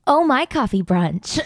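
drawn-out slogan, said slowly. Shown as a demonstration. Friends take turns pitching a cafe name: slow commercial read. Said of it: "There's so many ways you can say it".

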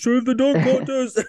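high, strained attempt at a big-man voice. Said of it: "How [name] goes".

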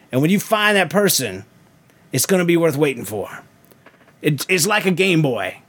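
folksy Southern preacher, rolling and emphatic; Southern preacher accent. Shown as a demonstration. Preacher advising a young man, hammed-up Southern accent. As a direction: preach it with a put-on Southern twang. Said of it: "I'll do it in a Southern accent".

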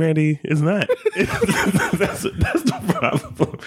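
laughing protest, slowing down. Real, not acted. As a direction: insist while laughing.

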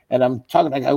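ordinary delivery

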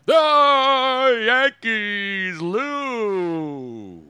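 long drawn-out announcer call; mock sports announcer. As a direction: stretch it like a home-run call. Mocking the Yankees call.

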